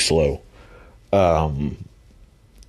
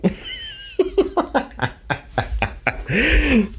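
long high burst of laughter; helpless high laughter; 3 dB quieter than usual. Real, not acted. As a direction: lose it at your own story. Laughing at the pop.

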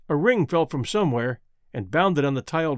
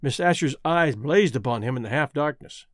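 ordinary delivery

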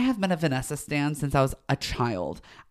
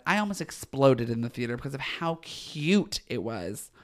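ordinary talk

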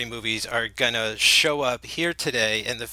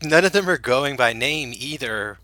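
ordinary talk, nothing marked

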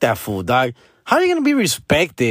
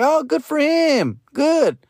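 exaggerated congratulating voice, pitched way up; mock proud cousin; 8 semitones higher than usual. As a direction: gush over a distant cousin like a proud aunt. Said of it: "you're like".

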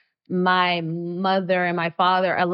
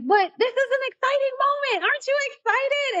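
much higher, eager and gushing; excited gush. Retold or imitated. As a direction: bubble over with excitement. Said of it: "you don't have to be so excited".

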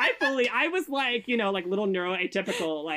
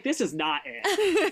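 drawn-out realization; 7 semitones higher, much slower than usual. Retold or imitated. Retold realization, slow.

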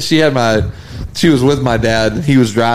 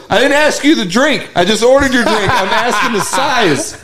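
higher, laughing through the exasperated dad; 10 semitones higher than usual. Retold or imitated. Dad snaps at his wife over the order, higher and laughing. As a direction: fight laughter while scolding.

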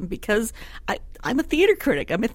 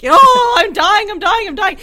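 high, loud hammed-up death throes; melodramatic dying player; 12 semitones higher, 11 dB louder, slower than usual. Pundit mocking a diving soccer player, hammed-up melodrama. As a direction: overplay the fake injury like a stage diva. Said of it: "nineteenth century melodrama".

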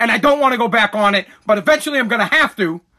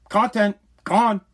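usual speaking voice

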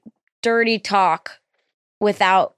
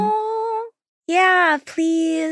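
high role-play voice; 9 semitones higher, much slower than usual. Pretend. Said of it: "This is every girl I talk to".

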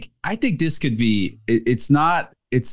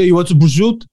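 higher, louder mock Jersey accent; 7 semitones higher, 6 dB louder, faster than usual. Jersey local offering prosciutto, in a put-on Jersey accent. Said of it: "where I grew up in Jersey".

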